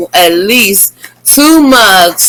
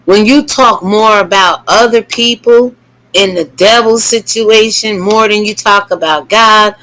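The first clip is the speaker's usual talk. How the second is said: ordinary delivery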